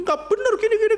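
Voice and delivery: high, squeaky child mimicking a grown-up; child's voice. Child echoing parents' gossip, high and squeaky. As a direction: pipe it up like a little kid parroting. Child repeats mocking the pastor.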